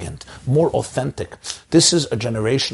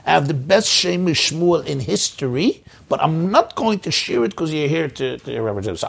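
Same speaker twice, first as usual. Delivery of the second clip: higher-pitched imitation of a rabbi's accent; Rabbi Grunfeld's classic accent; 6 semitones higher than usual. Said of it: "in his classic accent".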